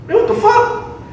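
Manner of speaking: high-pitched mock disbelief, amused; imitated outrage. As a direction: play his baffled protest way up high. Other man's reaction to gift card.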